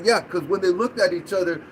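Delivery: ordinary talk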